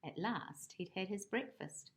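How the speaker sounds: ordinary delivery, nothing marked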